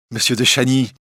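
put-on high, glad old lady's cry; joyful greeting. A narrator's reading. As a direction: greet a welcome visitor with delight. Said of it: "dit-elle joyeusement".

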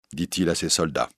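plain reading voice

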